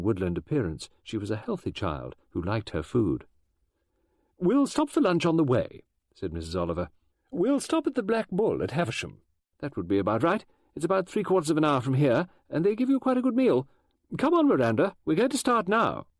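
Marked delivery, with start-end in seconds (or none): 4.4-16.1 high, lively gossiping woman voice; brisk chatty lady. Acted. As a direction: chatter on like an eccentric novelist. Mrs Oliver organising the trip.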